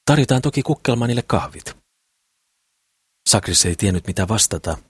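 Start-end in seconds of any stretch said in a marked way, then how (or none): 0.1-1.8 high-pitched, lively offer; exclaiming. A narrator's reading. Mimmi offering coffee to the visitor, exclaiming. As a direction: exclaim it in a lifted voice. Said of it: "huudahti Mimmi".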